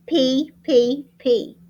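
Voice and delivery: ordinary talk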